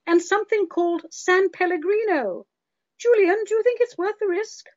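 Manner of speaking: high anxious panicky woman voice; shrill-free but high fretting patient, rattling off side effects; whiny worried woman. Retold or imitated. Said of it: "you hear these conversations in people's heads".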